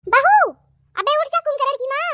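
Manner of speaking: calling loudly. Acted. Mother-in-law yells to wake her lazy daughter-in-law, loud yell. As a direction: shout her awake, exasperated. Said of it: "फिर से आवाज देती है".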